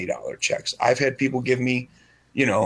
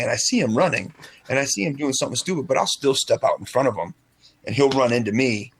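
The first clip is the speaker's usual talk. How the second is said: ordinary talk, nothing marked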